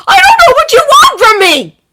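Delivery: high, loud tearful yell. A narrator's reading. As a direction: yell it back, near tears. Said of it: "Izuku yells back".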